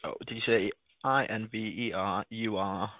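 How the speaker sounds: ordinary talk, nothing marked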